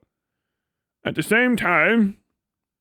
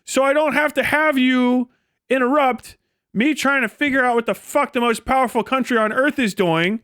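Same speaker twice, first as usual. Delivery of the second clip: ordinary talk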